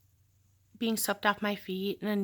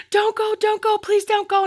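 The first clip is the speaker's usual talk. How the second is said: much higher, loud pleading scream; retold desperate screaming; 12 semitones higher, 8 dB louder than usual. Boyfriend thrown on her car as she backs out, desperate pleading scream. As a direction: scream and beg her not to leave.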